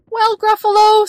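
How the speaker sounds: high, loud little mouse voice; bold mouse. Acted. Mouse addresses the Gruffalo, high confident squeak. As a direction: pipe up boldly to a monster.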